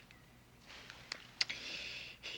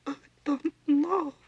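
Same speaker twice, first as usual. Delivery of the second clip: crying; 8 semitones higher, 4 dB louder than usual. Acted. Unsure mother breaking down before her son, crying. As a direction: voice breaking into tears, pitched higher.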